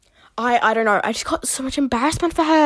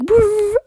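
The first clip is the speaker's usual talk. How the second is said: high, loud door whoosh; high whirring whoosh; automatic door noise; 9 semitones higher, 5 dB louder than usual. Retold or imitated. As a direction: mimic a toilet door sliding open. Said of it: "it goes, like".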